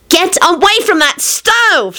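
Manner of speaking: high, drawn-out yell. A narrator's reading. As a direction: order them away in a high yell. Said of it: "Slodge yelled".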